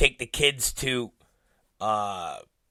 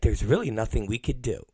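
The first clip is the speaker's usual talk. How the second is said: subdued agent; 6 semitones lower, 6 dB quieter than usual. Retold or imitated. Agent replies after apology.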